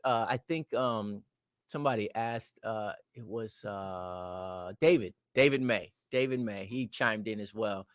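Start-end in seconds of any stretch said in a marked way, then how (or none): none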